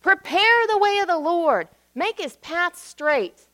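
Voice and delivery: high, loud prophet's call, slowing on the second half; John the Baptist proclaiming. Retold or imitated. John the Baptist calls out to the crowds, high and proclaiming. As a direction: cry it out like a prophet in the wilderness. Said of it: "we hear John saying".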